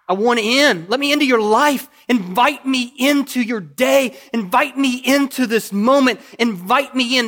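a hint of Jesus asking to come in, loud and high; Jesus pleading. Preacher voicing Jesus at the door, loud plea. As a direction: call out as Jesus begging to be let in.

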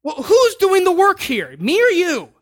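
voicing God, mildly fed up with the believer; God's voice. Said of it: "God's like".

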